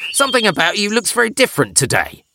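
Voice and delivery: high, fast frog voice; croaking frog. A narrator's reading. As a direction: chatter like a nosy frog. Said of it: "he croaked".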